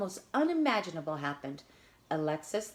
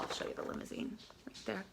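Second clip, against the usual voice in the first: whispered aside; 11 dB quieter, faster than usual. Real, not acted. Aside to listener.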